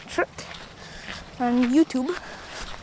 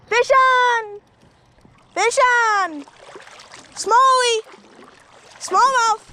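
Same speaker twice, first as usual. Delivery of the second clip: loud, high, thrilled yelling; excited shout; 10 semitones higher, 6 dB louder, much slower than usual. Real, not acted. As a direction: shout it out like you just hooked one. Hooked a smallmouth bass, calling out.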